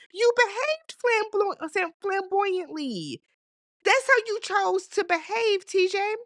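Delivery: lightly voiced Eddie, scolding; mock Eddie. Eddie telling Stacy how she behaved, lightly voiced high mock voice.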